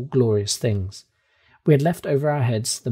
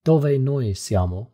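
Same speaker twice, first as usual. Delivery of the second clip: Italian question; slower than usual. A narrator's reading. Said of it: "now let us try Italian".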